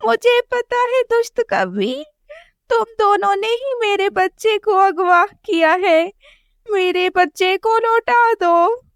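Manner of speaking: high, tearful accusing voice; mother sparrow confronts the crow through tears; crying mother sparrow. Acted. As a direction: sob out the accusation.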